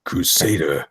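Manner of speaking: slow, low announcer-like delivery; Boyette impression. Host reading the comic tagline as Boyette would, slow impression. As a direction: intone each title word slowly and low. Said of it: "my best impression of the way".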